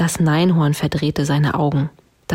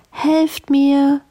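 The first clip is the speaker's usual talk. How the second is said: high princess cry from the tower; calling for help; 10 semitones higher, 4 dB louder than usual. A narrator's reading. Locked-up princess to passing animals, high call for help. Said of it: "rief die Prinzessin".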